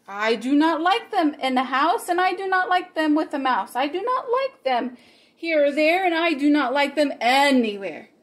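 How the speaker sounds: lively read-aloud with bouncy rhymes; story reading for children. A narrator's reading. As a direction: read the rhymes to the kids with fun. Reads Green Eggs and Ham aloud.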